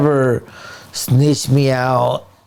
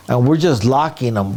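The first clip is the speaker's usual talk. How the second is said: usual speaking voice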